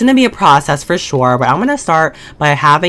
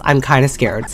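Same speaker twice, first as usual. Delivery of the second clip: normal talking voice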